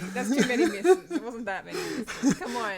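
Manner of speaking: loud, high, drawn-out laughing objection; laughing protest. Real, not acted. Teased player defends the missed shots, laughing protest. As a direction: protest through real laughter, slow and loud.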